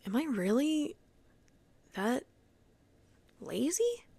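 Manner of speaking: halting question with high rising end; doubting herself. Retold or imitated. Worker questioning herself after criticism, doubtful. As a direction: pause between words, lift sharply on the last.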